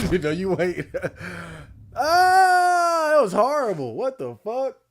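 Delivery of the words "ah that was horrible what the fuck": high, loud frightened cry; panicking gamer. Real, not acted. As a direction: cry out in shock. Scare in game.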